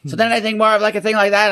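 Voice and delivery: very high, put-on Canadian trailer-park accent; Canadian pikey accent. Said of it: "it's like a Canadian pikey".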